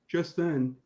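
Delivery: ordinary talk, nothing marked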